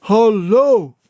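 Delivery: high muffled hullo from behind a door; answering call. A narrator's reading. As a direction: call back sharply from inside.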